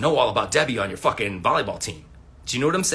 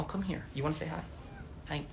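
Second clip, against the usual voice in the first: soft pet talk; 3 semitones higher, 12 dB quieter than usual. Real, not acted. Talking to his pet.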